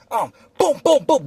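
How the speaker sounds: high punchy booms; imitated gunshots. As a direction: fire off each boom like a shot.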